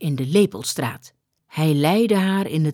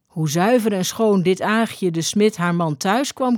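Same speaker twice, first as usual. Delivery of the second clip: ordinary delivery, nothing marked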